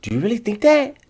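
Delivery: very high mimicking voice; high, ridiculing quote; mocking imitation. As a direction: parrot the question back in squeaky falsetto. Mimicking the host after "he goes.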